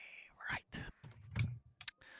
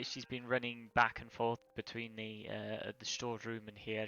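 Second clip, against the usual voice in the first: ordinary talk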